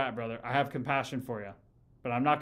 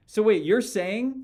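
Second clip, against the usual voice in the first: incredulous; 6 semitones higher, 7 dB louder, slower than usual. Real, not acted. Incredulous at a chat question.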